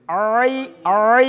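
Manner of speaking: "আই" drawn long from mid-throat with pressure; throat sound demo. Shown as a demonstration. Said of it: "কণ্ঠনালীর মাঝখান থেকে একটু চাপ দিয়ে". (কণ্ঠনালীর মাঝখান থেকে চাপ দিয়ে টেনে "আই")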